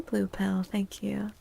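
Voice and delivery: ordinary delivery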